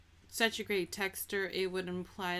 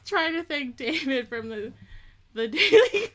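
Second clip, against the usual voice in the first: higher and louder, laughing through it; 6 semitones higher, 8 dB louder than usual. Real, not acted. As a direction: keep breaking into laughter. Laughter-broken attempt at shout-out.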